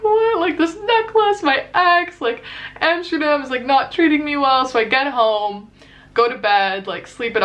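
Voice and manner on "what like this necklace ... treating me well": miserable wail. Retold or imitated. Lying in the rain, miserable.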